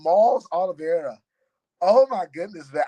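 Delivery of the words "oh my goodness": normal talking voice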